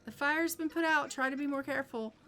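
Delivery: lightly voiced firefighter telling them off; fire department. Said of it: "The fire department is just like".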